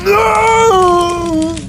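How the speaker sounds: very high, repeated screams of despair. Acted. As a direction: scream it as everything burns. Screams as papyrus burns to ash.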